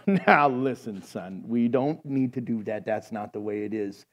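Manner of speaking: low, hushed fatherly admonishing; a hint of a patient old pastor, voice dropping; lightly voiced old minister. Old minister in a joke to lawn boy, gently admonishing.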